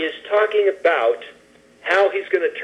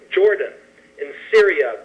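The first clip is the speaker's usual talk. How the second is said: ordinary talk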